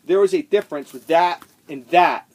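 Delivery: loud, emphatic declaration; exasperated, loud statement; loud insistence. Real, not acted. Ranting about backwards records.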